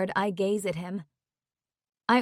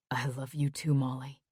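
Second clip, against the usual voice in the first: lower, softer male voice; Jax, tender reply; 7 semitones lower, 8 dB quieter than usual. Acted. Boyfriend answering Molly, low and tender. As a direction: murmur it back tenderly.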